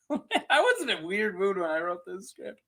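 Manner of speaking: normal talking voice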